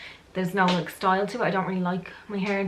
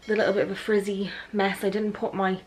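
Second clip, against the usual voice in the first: ordinary delivery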